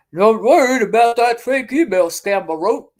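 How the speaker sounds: The Voice. Retold or imitated. Reading the rival's post in a loud mocking voice. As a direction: ham up a sneering voice for her post. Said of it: "read this in The Voice".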